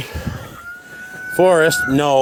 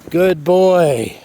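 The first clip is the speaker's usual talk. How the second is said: usual speaking voice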